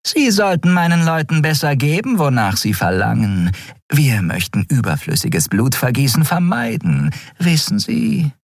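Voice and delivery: high, silky female villain; amused traitor Shiar. A narrator's reading. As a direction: toy with the captive, pitch swooping.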